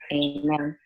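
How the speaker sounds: normal talking voice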